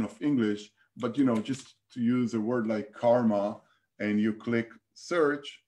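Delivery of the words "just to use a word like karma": normal talking voice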